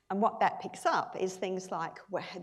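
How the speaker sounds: ordinary delivery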